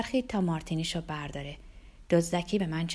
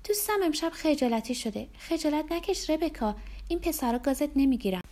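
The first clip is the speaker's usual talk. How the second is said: high, playful woman's voice; teasing Rebecca; 8 semitones higher than usual. Acted. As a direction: tease a shy friend in front of company. Rebecca teases her shy friend.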